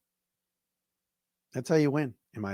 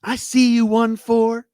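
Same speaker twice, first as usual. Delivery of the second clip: a lighter, higher replay of the announcer call; Dan Miller impression; 9 semitones higher, 7 dB louder than usual. Host repeats the touchdown call for listeners, lightly voiced. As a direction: lightly echo the radio call, a bit louder. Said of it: "That's my Dan Miller impression".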